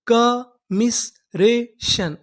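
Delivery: very slow, each syllable drawn out; कमिसरेशन stretched syllable by syllable. Shown as a demonstration. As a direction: spell out कमिसरेशन slowly for students. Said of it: "पूरी meaning".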